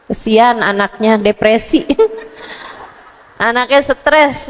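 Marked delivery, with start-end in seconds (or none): none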